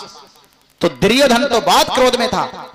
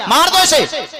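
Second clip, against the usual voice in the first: angry command; 6 semitones higher than usual. Acted. Draupadi seizes him, demands death.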